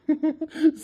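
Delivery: short high laugh. Real, not acted. Husband laughing at the letter, short high laugh. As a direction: chuckle at the letter. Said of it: "என்னைய பார்த்தா உங்களுக்கு சிரிப்பா இருக்குல்ல".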